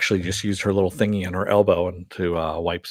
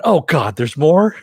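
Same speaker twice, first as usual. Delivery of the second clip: high, loud exasperated outcry; hammed-up disbelief, climbing higher; mock dismay; 8 semitones higher, 8 dB louder, slower than usual. Villain breaking the fourth wall, mock dismay.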